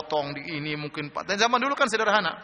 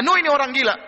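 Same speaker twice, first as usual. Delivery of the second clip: high, loud denunciation; scornful accusation; 7 semitones higher, 6 dB louder, faster than usual. Retold or imitated. Noah's people brand him insane, scornful. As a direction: imitate accusers declaring a man mad. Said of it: "kata mereka".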